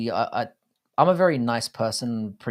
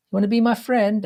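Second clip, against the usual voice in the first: imitating his little son; 9 semitones higher than usual. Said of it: "he'll just go up to random kids".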